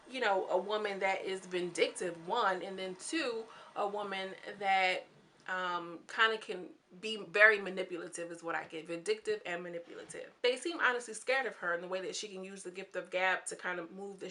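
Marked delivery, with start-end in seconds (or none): none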